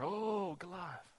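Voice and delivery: small, hushed mock dread; mock fearful. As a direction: cower softly, imitating scared soldiers. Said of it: "shaking in fear".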